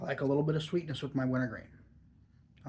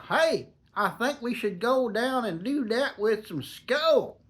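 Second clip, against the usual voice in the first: slow, higher Tennessee drawl; Nashville Tennessee accent; 8 semitones higher, slower than usual. Retold or imitated. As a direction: play a good old boy from Nashville, Tennessee accent. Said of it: "in his Nashville, Tennessee accent".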